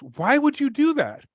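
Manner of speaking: high, drawn-out mock disbelief; incredulous finance guy. Finance guy questioning the buyer's choice, incredulous.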